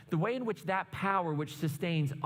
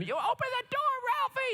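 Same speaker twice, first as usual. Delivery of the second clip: very high-pitched imitation of mom yelling; mock yelling mom; faster than usual. Mom yelling at boy through bathroom door, mock yell. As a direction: yell like an exasperated mom, hammed-up. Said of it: "like, yelling at him".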